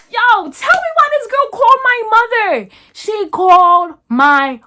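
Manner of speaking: high, loud outraged squeal. Real, not acted. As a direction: cry it out in indignant disbelief. Outraged recounting of the mom call.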